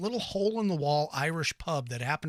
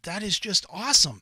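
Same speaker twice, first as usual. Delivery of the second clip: ordinary delivery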